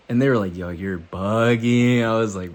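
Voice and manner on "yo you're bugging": mock disbelief. Friends' reaction acted out.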